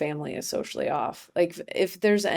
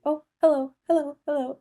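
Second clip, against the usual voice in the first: high-pitched mock hellos; overfriendly mom greeting; 9 semitones higher, 3 dB louder than usual. Mom pretending she knows everybody, high mock greeting. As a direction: toss high hellos around the room, imitating mom.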